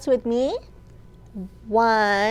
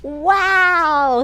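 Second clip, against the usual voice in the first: higher, louder wow of delight; owl's wow; 5 semitones higher, 6 dB louder than usual. Acted. Owl watches red butterflies, a high, loud wow. Owl exclaims in picture book.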